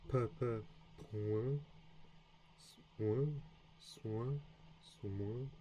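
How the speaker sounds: slow careful French nasals, coin, moi, point, soin; French nasal vowels. Shown as a demonstration. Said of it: "it's not gonna be as smooth".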